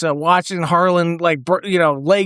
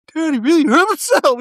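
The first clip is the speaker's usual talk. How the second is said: Tom Green; 9 semitones higher than usual. Retold or imitated. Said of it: "Tom Green's like".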